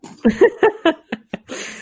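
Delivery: high burst of laughter; loud laugh. Real, not acted. Laughing at lost chat.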